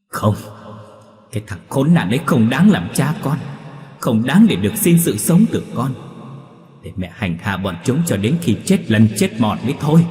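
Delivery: high, loud, impassioned rage; vengeful refusal. A narrator's reading. Bà Hoa rejects her son's plea for mercy, vengeful and angry. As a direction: refuse furiously, vowing revenge.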